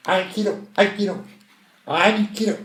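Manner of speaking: high-pitched fake Japanese call; mock Japanese. Contestant showing off Japanese to hail a taxi, mock Japanese accent. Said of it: "je connais le japonais, on a, on appelle en disant".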